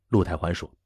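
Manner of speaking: ordinary delivery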